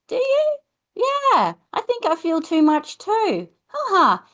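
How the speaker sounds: put-on high-pitched child replying; child's voice. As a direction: squeak it like a small child.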